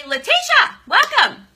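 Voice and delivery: loud, high-pitched welcome; excited greeting. Real, not acted. Host spots a new viewer arriving, excited. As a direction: call out the name in high delight.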